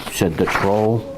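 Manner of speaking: plain reading voice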